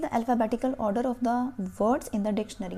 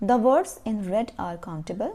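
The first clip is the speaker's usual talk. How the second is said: very slow, each word spaced; slow teacher dictation of a rule; 3 dB louder, much slower than usual. Shown as a demonstration. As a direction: dictate it so pupils can write. Teacher reading instructions aloud.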